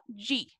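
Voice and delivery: G sounded as Portuguese "dji"; lone DE as "dji". Shown as a demonstration. Teacher explaining the preposition, pronounced "dji". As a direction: say G like English "jee". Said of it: "le vamos a pronunciar como".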